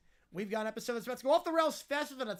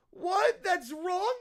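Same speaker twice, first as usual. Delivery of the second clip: put-on high, loud childish disbelief; indignant mock-tantrum child; bratty outrage; 3 semitones higher, 4 dB louder, much slower than usual. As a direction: blurt it like an offended kid. Bratty kid outraged at answer.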